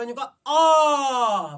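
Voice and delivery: "आ" stretched very long and loud, mouth wide open; teacher demonstrating the widest-open vowel "आ". Shown as a demonstration. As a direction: bellow a long, gaping "आ". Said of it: "तो फिर बड़ा खोल लो".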